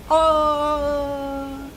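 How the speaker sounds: high frightened yelp; sharp shriek of surprise; startled scream. Real, not acted. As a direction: cry out in real fright. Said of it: "I screamed like I was falling off of a cliff".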